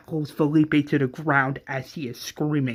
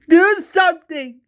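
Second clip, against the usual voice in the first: much higher and louder, near crying; desperate cry; 9 dB louder than usual. Acted. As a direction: beg them to act, breaking. Desperate plea for the dying man.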